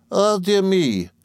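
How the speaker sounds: long, high-pitched sigh of dismay. A narrator's reading. Miss Murdstone greeting the returned boy, sighing. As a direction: draw it out high and slow, like a sigh. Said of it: "sighed Miss Murdstone".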